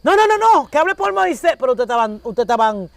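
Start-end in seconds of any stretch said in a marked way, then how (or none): 0.0-1.5 the people of Israel pleading in fright, hammed-up; mock frightened pleading. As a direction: beg like scared people backing away. Frightened people begging God stop.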